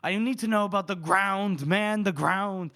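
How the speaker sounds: mock officer's shout, last words stretched; acted yelling. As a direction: yell it like an exasperated general. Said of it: "He's, like, yelling at".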